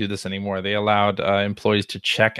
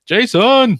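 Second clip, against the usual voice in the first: high, loud shouted name; retold shout; 13 semitones higher, 8 dB louder than usual. Avatar in Heavy Rain shouting for Jason. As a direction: belt the name out across the room.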